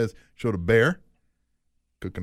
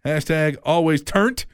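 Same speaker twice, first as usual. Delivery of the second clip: ordinary talk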